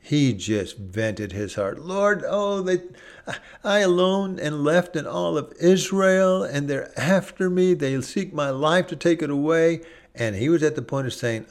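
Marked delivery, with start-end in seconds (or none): 1.8-9.8 higher, pleading voice of a desperate prophet; despairing Elijah. Acted. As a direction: cry out to God, higher and anguished.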